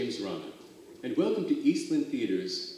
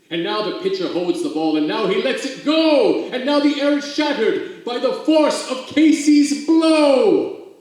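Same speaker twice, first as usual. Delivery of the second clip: faster, louder and higher to a peak; building climax; 12 semitones higher, 13 dB louder than usual. Acted. Reader builds tension to Casey's swing, rising excited climax.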